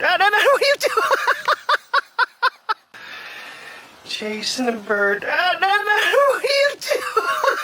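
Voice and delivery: very high, loud squealing laughter through words; laughing hard. Real, not acted. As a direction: crack up as the dog chases a bird. Dog chasing bird, laughing hard.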